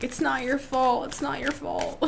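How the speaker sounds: high, mock tender movie line; Good Will Hunting quote. Reader imitates Good Will Hunting scene, mock tender. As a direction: imitate the famous film comfort line lightly. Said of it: "The scene where he's like".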